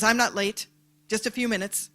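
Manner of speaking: the late driver insisting he's barely late; defensive driver. Retold or imitated. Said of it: "late and defensive".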